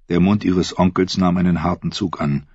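ordinary delivery

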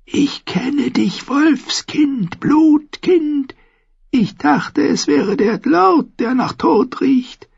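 slow, high, eerie recognition; name the child with dread; slow high old woman, awed; high crone. A narrator's reading. Seeress knows the child.

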